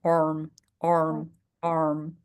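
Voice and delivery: arm with the r pulled back inside the mouth; inward English r. Shown as a demonstration. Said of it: "It goes inside".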